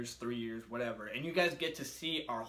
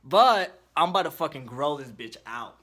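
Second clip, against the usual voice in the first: loud hyped declaration; excited shout; 10 dB louder than usual. Real, not acted. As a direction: shout it out, fired up. Hyped about regrowing his tooth.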